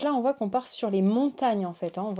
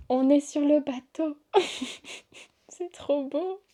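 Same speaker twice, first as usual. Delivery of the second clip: high, giggly excitement; delighted wonder; 5 semitones higher than usual. Real, not acted. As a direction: gush with a laugh breaking in. S'extasie devant la carte, en riant. (ravissement aigu et rieur)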